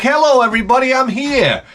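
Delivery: very high, hammed-up hello to the room; mock greeting. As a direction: call out in a comic high voice. Voices skin announcing itself at party.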